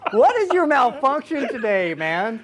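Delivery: can't get the words out for laughing. Real, not acted. Co-host cracking up at his partner's flubbed pitch, laughing.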